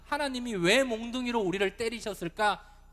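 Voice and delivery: loud puzzled question. Acted. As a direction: cry out the people's bewildered why. Voices the people's puzzled question.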